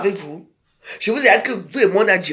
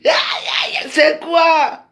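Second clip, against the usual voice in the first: cri indigné; 9 semitones higher, 6 dB louder, slower than usual. Real, not acted. Outraged cry at viewers' comments.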